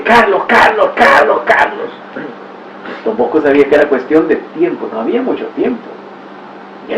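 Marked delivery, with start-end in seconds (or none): 0.0-1.9 mock calling out. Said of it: "y gritándole".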